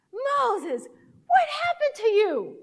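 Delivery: high, loud, drawn-out astonishment; amazed exclamation. Retold or imitated. Worker seeing the changed patient return, amazed shout. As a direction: cry out in joyful amazement.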